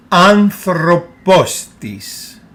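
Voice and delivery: "Anthropos tis" slowly as one word, accents on first and last syllables of Anthropos; two accents, one word. Shown as a demonstration. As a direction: stress both AN and POS in "Anthropos tis", slow and loud, tis unstressed. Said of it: "two syllables that have an accent".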